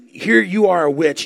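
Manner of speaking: ordinary talk, nothing marked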